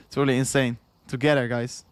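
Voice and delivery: usual speaking voice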